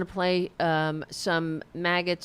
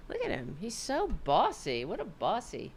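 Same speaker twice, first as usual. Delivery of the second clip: fond murmur; 9 dB quieter than usual. Real, not acted. Fond remark on the pet.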